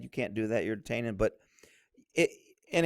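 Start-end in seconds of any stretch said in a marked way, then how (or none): 0.0-1.1 low, soft mock objection; imitating a complaining constitutionalist, low and soft. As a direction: mutter it like a sulky critic. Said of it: "some constitutionalists are gonna be mad".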